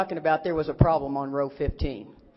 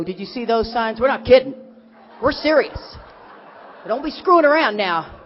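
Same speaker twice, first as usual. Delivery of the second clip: mock stern warning; 3 semitones higher, 7 dB louder than usual. Voicing the road warning signs.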